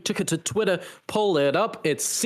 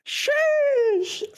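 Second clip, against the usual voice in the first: long, very high-pitched "sheesh"; stretched falsetto reaction to an impressive stat; drawn-out hype cry. Real, not acted. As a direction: squeal it out and hold the note.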